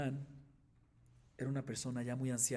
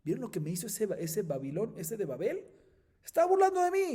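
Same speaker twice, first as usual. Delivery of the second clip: high, loud indignant outburst; furious teacher peaking on the last line; angry rabbi; 8 semitones higher, 9 dB louder than usual. Acted. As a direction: rant to your students about the insult, climbing to a cry. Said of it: "Rabbi Yohanan está todo bravo".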